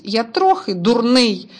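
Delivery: usual speaking voice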